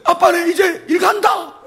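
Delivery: high, loud call, slow at the end; calling to daughter. Retold or imitated. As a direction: call across the webcam cheerfully. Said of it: "뭐 이렇게 하고요".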